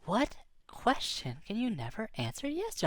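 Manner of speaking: plain reading voice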